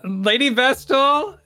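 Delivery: high, drawn-out questioning call. Acted. Young lady summoning Lady Vestal, calling out. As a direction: stretch the name out in a high call. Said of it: "And she calls out".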